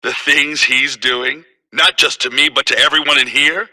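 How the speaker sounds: wronged captive working up to fury; rising indignation. Acted. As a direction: let the grievance build, pitch climbing. Captive rails against an abuser.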